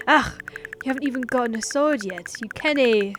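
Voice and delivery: put-on loud, exasperated Scottish accent. Combat master to an unarmed newcomer, exasperated attempt at Scottish. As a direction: scold in an amateur Scottish accent. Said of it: "I think he's a Scottish man".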